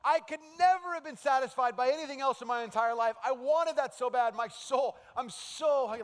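high, loud, overjoyed craving; excited longing. Retold or imitated. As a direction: gush as if starving and finally fed. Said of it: "And he's so excited".

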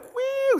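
very high, airborne squeal; gleeful child's woo. Retold or imitated. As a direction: squeal like a kid on a swing ride. Thrilled three-year-old voiced.

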